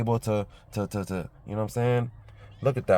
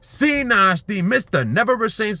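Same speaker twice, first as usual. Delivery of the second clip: slow, high, hammed-up hype-man intro; grand sign-off; 4 dB louder, slower than usual. Vlogger signing off to his viewers, slow and high like a hype announcer. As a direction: crown yourself the chosen one, drawn out and loud.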